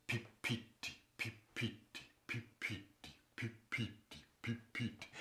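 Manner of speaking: quiet breathy pipiti steps; buffalo walking sound. Shown as a demonstration. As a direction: tap out soft hoof steps. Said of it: "the sound he makes when he walks".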